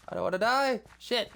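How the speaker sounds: sudden high, loud panic; panicked yelp. Real, not acted. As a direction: cry it out in a jolt of fear. Sudden panic while building.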